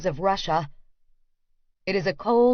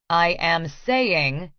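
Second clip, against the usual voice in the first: slow, each word spelled out carefully; pointed big-brother enunciation; enunciated; 3 semitones lower, much slower than usual. A narrator's reading. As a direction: lean on every syllable, slowly. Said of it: "Daniel enunciates".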